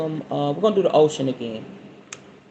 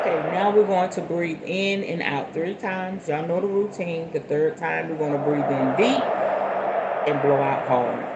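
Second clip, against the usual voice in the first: guided meditation; 4 dB quieter than usual. Acted. Guiding viewers into a calm sitting posture. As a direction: settle the listeners into their seats. Leads breathing count.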